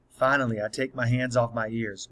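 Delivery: ordinary delivery, nothing marked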